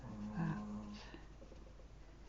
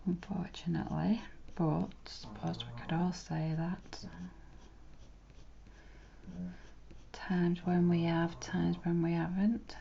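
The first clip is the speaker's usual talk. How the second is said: whispering; 6 dB quieter than usual. Real, not acted. Whispers in an ASMR drawing video.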